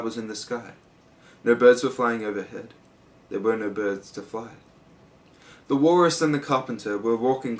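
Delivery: expressive storybook verse reading; poem recitation. A narrator's reading. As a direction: read the poem aloud with theatrical phrasing.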